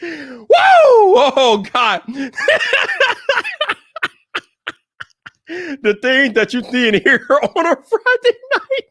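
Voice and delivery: high, loud laugh-through speech. Real, not acted. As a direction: talk while cracking up. Laughing fit after name slip.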